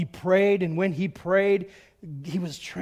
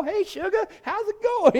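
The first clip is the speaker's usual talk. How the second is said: high-pitched mock Southern drawl; 13 semitones higher, 3 dB louder than usual. Preacher mimicking friend for laughs, high Southern drawl. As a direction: imitate a sugary Southern greeting, pitched way up. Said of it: "she'd talk like that".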